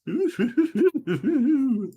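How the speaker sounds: long high-pitched giggle; helpless run of high laughter; real laughter. Real, not acted. Host cracking up at his own accent, laughing.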